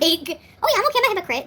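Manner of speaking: high sarcastic mock admission; mock them agreeing they're hypocrites; mocking critic. Mimicking a critic, high and sarcastic. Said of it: "you don't see how that makes you a hypocrite".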